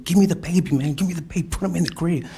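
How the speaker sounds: high, loud, repeated orders; urgent retold demand. Retold argument over baby.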